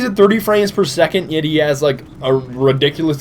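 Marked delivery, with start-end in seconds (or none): none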